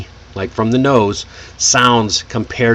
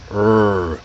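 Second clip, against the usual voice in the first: light "rrr" growl from behind the teeth; sustained American R sound, tongue curled back; 3 semitones lower than usual. Shown as a demonstration. Teacher demonstrating the tongue position, lightly growled "rrr". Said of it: "sound like a light growl".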